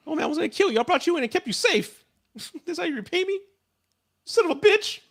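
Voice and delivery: very high, squeaky outrage; hammed-up high-pitched grievance; mock betrayed complaint. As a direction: whine it high, half laughing, as if double-crossed. Voices Michael betrayed after helping.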